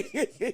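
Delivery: a short soft chuckle; quiet laugh at his own joke; brief amused chuckle. Real, not acted.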